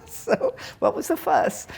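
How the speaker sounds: high, slow, laughing. Real, not acted. Teacher savouring a master's last words, laughing. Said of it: "I like that".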